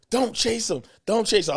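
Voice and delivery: mock urgent dispatcher; operator warning. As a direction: imitate the operator telling you to stop. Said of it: "and she's, yeah".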